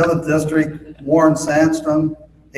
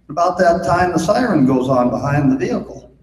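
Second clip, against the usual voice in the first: normal talking voice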